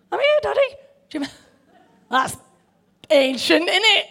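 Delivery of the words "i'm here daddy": Gregory impression. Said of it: "I was gonna do a Gregory".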